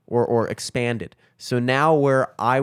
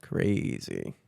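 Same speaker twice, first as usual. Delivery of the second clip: very low, soft echo; low mutter; 9 dB quieter than usual. Real, not acted. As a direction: drop it low and quiet. Echoed word, very low and soft.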